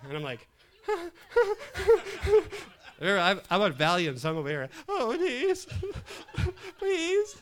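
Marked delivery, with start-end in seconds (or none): 0.8-3.0 high, loud comic wailing; mock sobbing. Patient hearing doctor and nurse argue, mock sobbing. 4.9-7.4 high, loud whimpering plea; mock tearful pleading. Patient on Valium begging during the argument, mock tearful pleading.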